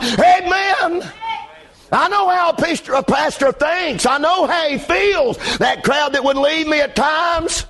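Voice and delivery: high, driving pulpit shout; full-force preaching. Real, not acted. Pastor telling how he blames himself, preaching hard. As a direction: preach with fire, pitched well above talk.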